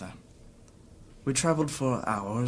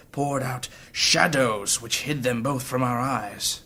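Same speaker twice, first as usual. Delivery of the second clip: ordinary delivery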